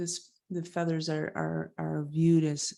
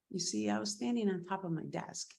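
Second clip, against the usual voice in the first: ordinary talk, nothing marked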